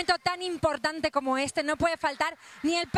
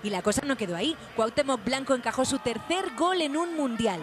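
news voiceover; 7 semitones lower than usual. A narrator's reading. Reporter narrating the match for viewers, news read.

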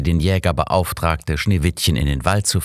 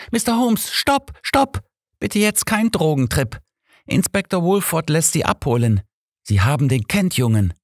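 put-on much higher young woman's voice, urgent; a young maid crying stop, pitched much higher; 12 semitones higher than usual. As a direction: burst through the door with a high, urgent plea. Alice bursts in urgently.

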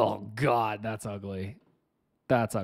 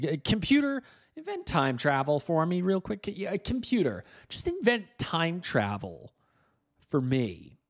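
mock demand; 8 semitones higher than usual. Mock ordering the computer.

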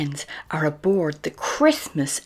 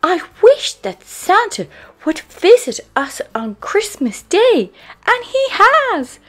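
put-on very high, loud, overjoyed little girl; Peppa; 13 dB louder than usual. A narrator's reading. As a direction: build to a slow, drawn-out cheer. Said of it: "Peppa cries".

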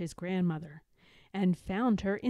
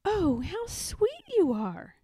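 higher, slow cooing little cub; tender cooing; 10 semitones higher than usual. A narrator's reading. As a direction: coo over a baby bird. Mother bear cub cooing at bird.

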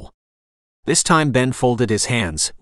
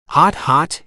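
higher-pitched narrated exclamation; exclaiming; 5 semitones higher than usual. A narrator's reading. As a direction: yelp it lightly, pitched up. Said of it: "he exclaimed".

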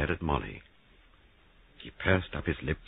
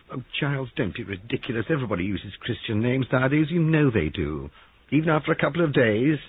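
higher-pitched, exasperated dismissal; exasperated protest; 8 semitones higher, 3 dB louder than usual. Acted. Molly dismissing Giles's jealousy, exasperated. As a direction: brush off the accusation.

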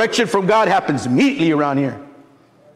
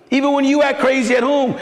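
ordinary talk, nothing marked

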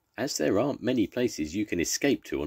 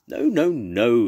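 slow, loud, higher exasperated cry of no; 6 semitones higher, 8 dB louder, slower than usual. Acted. Captain stopping his crew's singing, loud exasperated cry. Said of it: "he cried".

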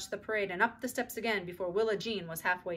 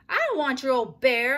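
louder, higher retort; defiant; 5 semitones higher, 10 dB louder than usual. A narrator's reading. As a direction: refuse it loudly. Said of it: "answered Susan".

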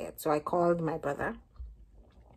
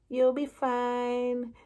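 slow, drawn-out reassurance; mock reassuring; 6 semitones higher, much slower than usual. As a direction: soothe her slowly. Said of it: "So she was like".

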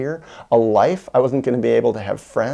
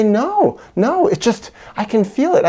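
high insistent denial; 10 semitones higher, 4 dB louder than usual. Retold or imitated. Mom denying hiding gum.

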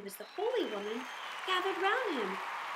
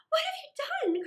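much higher, a shocked cry; horrified princess; 5 dB louder than usual. Acted. Princess seeing Aladdin stab the holy woman, horrified cry. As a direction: cry out in horror. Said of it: "cried the princess".

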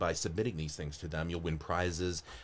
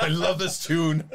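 put-on accidental Scottish accent; 9 semitones higher, 6 dB louder than usual. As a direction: enthuse in a badly faked Scottish accent. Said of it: "Now I'm Scottish".

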